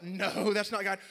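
drawn-out high, loud no; hammed-up emphatic refusal; acted loud denial. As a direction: deny it at full volume.

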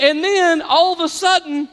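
normal talking voice

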